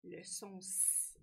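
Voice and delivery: "S" sounded as a long sss; held S sound. Shown as a demonstration. Teacher asks why spelling matters, "S" as a held hiss. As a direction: sustain the hiss on "S" instead of naming the letter. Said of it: "si j'ai le son S".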